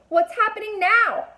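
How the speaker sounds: high, loud frightened cry; frightened yell. A narrator's reading. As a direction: yell in panic as the ground shakes. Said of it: "Connor yelled".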